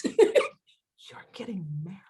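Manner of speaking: slow, breathless whispered disbelief; stunned whisper. Acted. Friends reacting to her engagement, stunned slow whisper. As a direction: whisper it slowly, as if astounded.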